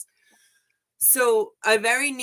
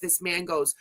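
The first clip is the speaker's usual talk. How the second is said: usual speaking voice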